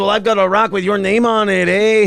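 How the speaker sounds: lightly put-on Mexican accent. Caller greeting the host in a put-on Mexican accent. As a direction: drop into a cartoon Mexican accent. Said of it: "hardcore Mexican accent".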